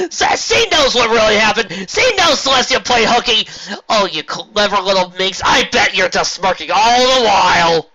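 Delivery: loud, high, slow ranting; frantic rant. A narrator's reading. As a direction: rant in disbelief. General rants.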